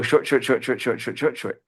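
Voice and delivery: rapid-fire, evenly ticking repetitions of "short"; quick short syllables. Shown as a demonstration. Lecturer showing eight beats made of shorts, quick and evenly counted. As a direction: rattle off each SHORT as one brisk, even beat. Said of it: "a long syllable lasts exactly twice as long as a short syllable".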